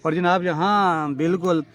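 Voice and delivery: usual speaking voice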